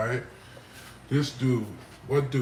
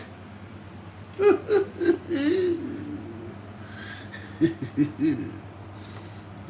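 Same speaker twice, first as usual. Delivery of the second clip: high breathless laugh; 4 dB quieter than usual. Real, not acted. Laughing at own teaser.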